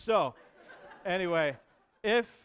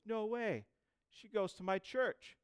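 amazed; 8 semitones higher, slower than usual. Retold or imitated. Buddy's amazed reaction, acted out.

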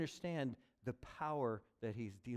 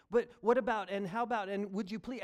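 high, loud badgering; pressing questions; 7 semitones higher, 8 dB louder than usual. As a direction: keep pushing for more, loudly. Imagined pleading questions.

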